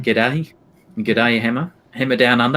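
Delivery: Australian greeting drifting towards New Zealand; Aussie accent. Shown as a demonstration. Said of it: "say good day in an Aussie accent".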